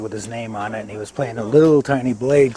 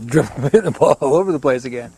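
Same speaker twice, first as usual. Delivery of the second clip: laughing through the words, louder; amused; 2 semitones higher, 7 dB louder than usual. Real, not acted. Golfer admits twenty wasted years to interviewer, laughing. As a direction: chuckle at your own failure. Laughs at his own failure.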